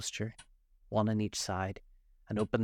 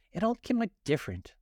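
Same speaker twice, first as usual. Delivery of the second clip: thoughtful; 11 semitones higher, 3 dB louder than usual. A narrator's reading. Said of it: "repeated thoughtfully".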